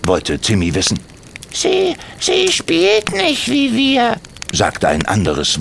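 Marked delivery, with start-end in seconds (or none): 1.5-4.2 put-on very high child's voice, halting repeats; a guilty kid stumbling over words; stammering boy. Another kobold child stammers.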